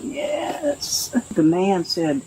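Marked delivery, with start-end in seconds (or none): 0.0-1.1 a tiny, high, breathless whispered yes; timid little yes. Retold or imitated. Child answering the man's question, whispered. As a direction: barely voice it like a scared child.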